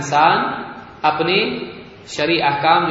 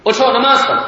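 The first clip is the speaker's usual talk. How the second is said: louder, higher parental order; raised-voice mock telling-off; acted scolding; 6 semitones higher, 5 dB louder than usual. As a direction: scold a child into getting up. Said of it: "ڈانٹ رہے ہیں".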